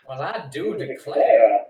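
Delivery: Southern dandy voice. Retold or imitated. Player bids the stream farewell as a Southern dandy. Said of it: "a Southern dandy's voice".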